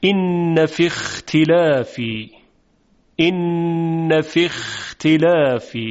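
slow recited إن في اختلاف with rough خ; Quranic recitation. Shown as a demonstration. Tajwid teacher reciting a verse slowly for students. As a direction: chant إن في اختلاف slowly, throaty خ in اختلاف. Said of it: "demonstrating Quranic recitation".

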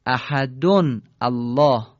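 احدون الله slow, the nun said clearly with kasra: ahadunil-lah; احدون الله joined. Shown as a demonstration. Teacher demonstrating joined tanwin for students, slow and emphatic.

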